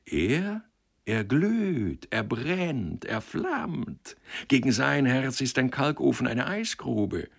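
high, drawn-out words of mock ardour; gushing rapture. A narrator's reading. Matchmaker assuring the woman of the groom's ardour, rapturous.

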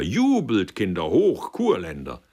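exultant welcome, called out; jubilant call. A narrator's reading. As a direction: rouse the company to cheer. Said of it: "rief Stickler".

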